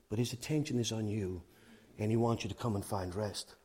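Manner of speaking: low, soft, measured assurance; tender preaching. Real, not acted. Preacher telling listeners God wants them to rest, tender assurance.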